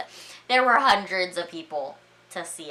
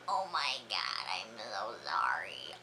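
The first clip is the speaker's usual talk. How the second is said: low, very soft, drawn-out apology; acted small-voiced horror; mortified apology; 9 semitones lower, 10 dB quieter than usual. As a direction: sink into a hushed, embarrassed sorry.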